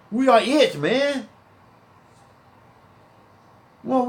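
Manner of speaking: high, loud pulpit preaching; fiery preaching. Acted. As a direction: preach it out to the street, full force. Preaching scripture at full force.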